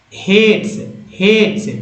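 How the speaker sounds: हेट्स said hates with a long a; हेट्स, ate sound then ts; word demo. Shown as a demonstration. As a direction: repeat हेट्स crisply, hay-ts. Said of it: "ए के बाद ई है तो हेट्स".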